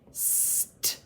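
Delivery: ST as voiceless hiss and tap; long s, then a crisp t, no vowel; sounding out. Shown as a demonstration. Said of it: "Then I heard a t".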